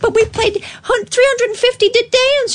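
put-on dancer voice. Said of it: "enjoying the voices".

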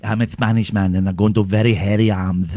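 low, lightly hammed Spanish; lightly Spanish accent. Comic Spanish man boasting, light mock Spanish accent. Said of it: "let's go for Spanish".

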